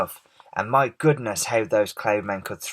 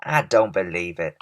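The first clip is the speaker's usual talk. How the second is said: ordinary delivery, nothing marked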